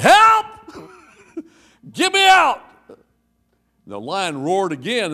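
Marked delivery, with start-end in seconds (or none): 0.0-2.6 high, loud cry of help; hammed-up yell for help; mock yell for help. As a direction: squeal it like a panicked man in a gorilla suit. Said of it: "and the man yelled".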